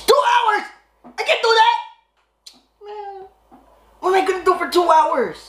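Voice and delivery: very high whiny outburst; whiny man child. Acted. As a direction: wail it like a spoiled kid. Outraged at two-hour wait.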